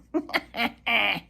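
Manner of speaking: mocking bird chuckle; jay chuckle. A narrator's reading. Sammy Jay chuckles at Reddy, mocking. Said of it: "he chuckled so that Reddy heard him".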